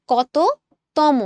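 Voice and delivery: কততম split slowly, "koto ... tomo"; slow, separated কততম with clear o endings; demonstrated pronunciation. Shown as a demonstration. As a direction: pause inside কততম, each half ending in o. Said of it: "আমাদের উচ্চারণটা কিন্তু এরকম".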